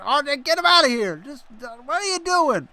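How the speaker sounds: loud, high-pitched scolding; exasperated outburst. Real, not acted. Commentator telling colleague to remove an intruder, exasperated. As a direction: burst out at a bungling colleague.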